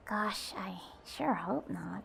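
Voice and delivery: slow, breathy whisper to herself; worried whisper. Acted. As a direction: whisper the fear under your breath. Alone, worried about demons.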